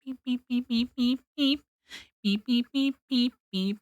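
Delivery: soft, evenly spaced little beeps; imitated beeping. Real, not acted. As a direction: beep steadily like a small machine, under your voice. Idle beeping noises while searching.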